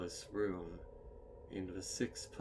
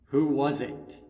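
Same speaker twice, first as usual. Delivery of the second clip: raised, sharp demand from the dark; 6 semitones higher, 14 dB louder than usual. Acted. Twilight demanding an answer in the dark, sharp and raised. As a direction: call out who did it, louder.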